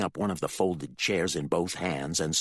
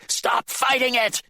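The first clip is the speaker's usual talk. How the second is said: much higher, louder command; angry shout; 7 dB louder than usual. Acted. The father yells at Candy, angry command. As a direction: yell her down.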